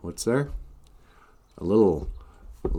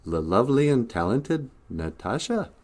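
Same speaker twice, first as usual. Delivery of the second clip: ordinary delivery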